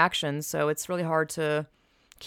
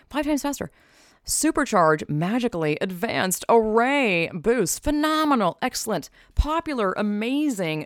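buzzwords read with showy, pitched-up sales energy; mock ad hype; 4 semitones higher, slower than usual. Vlogger parodying Apple marketing buzzwords as a hyped ad. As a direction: hype every single word like a product launch. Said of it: "that they use for the products".

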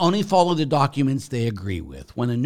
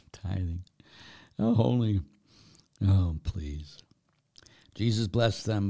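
normal talking voice

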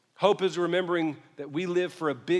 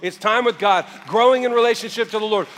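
ordinary talk

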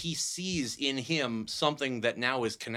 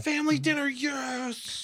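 excited high call; 10 semitones higher, slower than usual. Acted. Excited call at family dinner.

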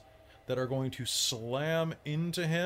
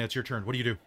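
ordinary talk, nothing marked

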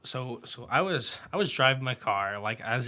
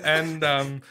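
ordinary talk

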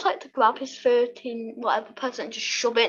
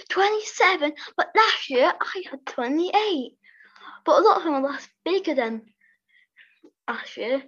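spoiled brat; 5 semitones higher than usual. Retold or imitated. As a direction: whine it like a bratty child. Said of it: "shows how much a spoiled brat".

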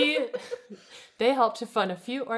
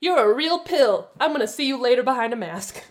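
mock threat; 5 semitones higher, 9 dB louder than usual. Mock villain voice about the woman.

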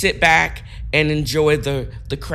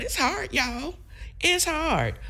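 silly clown; 6 dB quieter than usual. Acted. Dog-talk squeal about holding Ethel.

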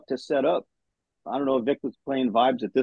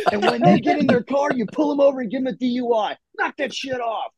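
higher-pitched, hammed-up general working the room; mock rowdy general; 8 semitones higher than usual. Retelling a general's speech to the battalion, hammed-up and higher. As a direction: bark it like a general calling out each section.

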